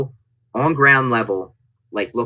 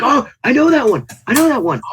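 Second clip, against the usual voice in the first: high, loud gleeful recognition; excited fan; 5 dB louder, faster than usual. Retold or imitated. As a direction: squeal it like a thrilled fan. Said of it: "You're like".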